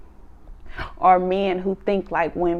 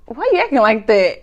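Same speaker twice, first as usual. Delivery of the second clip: laughing quote; 5 dB louder than usual. Retold or imitated. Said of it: "He was like".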